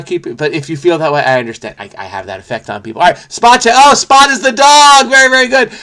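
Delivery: normal talking voice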